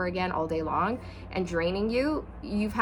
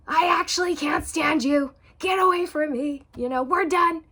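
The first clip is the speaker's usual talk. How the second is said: louder, much higher blurted rejection; suppressed partner finally snapping at spouse; explosive outburst; 7 semitones higher, 6 dB louder than usual. Retold or imitated. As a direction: let it all burst out at once. Outburst after suppressing truth.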